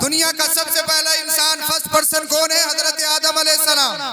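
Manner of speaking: high, loud rhetorical oratory; full-force preaching. Acted. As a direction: proclaim it to the whole crowd, high and loud. (पूरे ज़ोर की बुलंद तक़रीर)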